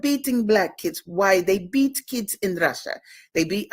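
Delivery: high-pitched, lightly put-on Russian accent. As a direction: imitate a Russian lady's accent, halfheartedly. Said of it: "this Russian lady was like".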